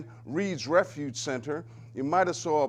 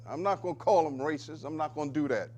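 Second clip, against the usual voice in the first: ordinary delivery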